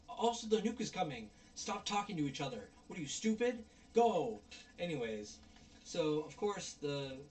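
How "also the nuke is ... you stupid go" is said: high reenacted yell, ending in a loud burst; retold exasperated yelling. Viewer retelling yelling as the nuke comes, exasperated yelling. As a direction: berate the dawdlers, building to a yell. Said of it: "I'm yelling and screaming".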